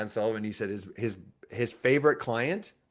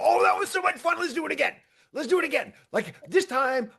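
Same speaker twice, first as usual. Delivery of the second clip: much higher, fast gleeful voice; delighted soul; 3 dB louder, faster than usual. Retold or imitated. Soul back in heaven after dying, much higher and gleeful. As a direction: gush like a kid after a ride. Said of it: "and I go".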